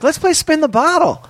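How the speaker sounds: very high mock-female voice; imitated woman. Porn star proposing a party game over drinks, imitated high female voice. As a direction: imitate her in a high, put-on voice. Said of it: "She's like".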